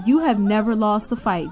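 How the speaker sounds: usual speaking voice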